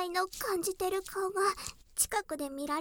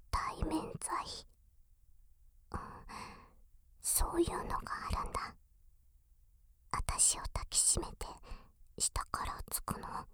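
intimate whisper; 6 dB quieter than usual. Acted.

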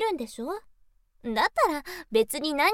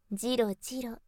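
ordinary talk, nothing marked

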